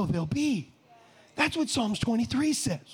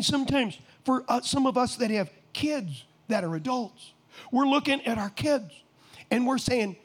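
normal talking voice

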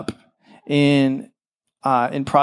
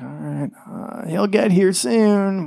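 casual friends; 4 semitones higher, slower than usual. Retold or imitated. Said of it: "being like".

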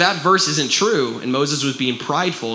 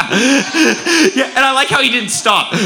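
laughing loud and high, barely getting the words out; real laughter; 11 semitones higher, 4 dB louder, slower than usual. Real, not acted. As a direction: burst out laughing at the joke. Laughs at Moses verse.

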